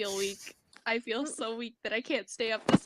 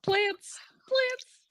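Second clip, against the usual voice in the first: high, louder excited shout; excited cry; 12 semitones higher, 3 dB louder than usual. Real, not acted. Caller blurts the word to the group, high and excited. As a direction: blurt it out, thrilled. Sudden exclamation.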